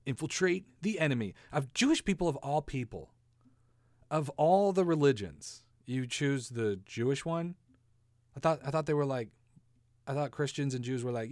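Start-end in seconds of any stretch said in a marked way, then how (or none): none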